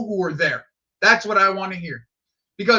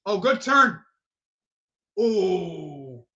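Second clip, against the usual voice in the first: ordinary talk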